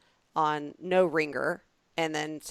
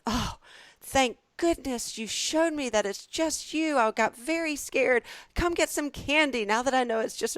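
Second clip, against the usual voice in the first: relieved and sweet; 8 semitones higher than usual. Retold or imitated. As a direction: reassure the little boy, all sugar. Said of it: "And so I said".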